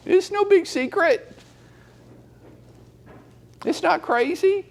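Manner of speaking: high, mocking, loud voice of someone who skips the gym; whiny mimic. Coach mimicking a whiny excuse-maker, high mock voice.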